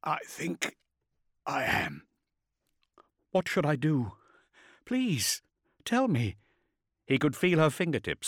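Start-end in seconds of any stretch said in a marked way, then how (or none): 0.0-2.1 soft, halting, failing voice; dying soldier, barely managing; weak, strained warrior. A narrator's reading. Dying warrior answers her. 3.3-6.4 high, pleading woman; tearful plea in a character voice; Cyrene, pleading. A narrator's reading.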